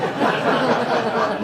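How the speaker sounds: sudden loud high laughter. Real, not acted. Laughing at his dislike of the phrase. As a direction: burst out laughing.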